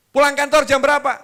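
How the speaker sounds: high, loud nagging; furious wife berating her husband for coming home late; angry scolding wife. Acted.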